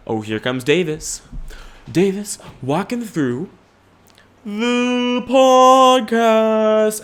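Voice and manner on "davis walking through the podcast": announcer voice. Acted. Announcer hype for Davis.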